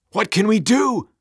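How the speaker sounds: high, loud panicked wail; desperate demon cry. A narrator's reading. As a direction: cry out helplessly to your master. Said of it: "one of the demons cried".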